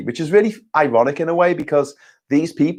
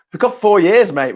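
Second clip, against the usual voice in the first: exasperated outburst; 7 semitones higher, 3 dB louder than usual. Real, not acted.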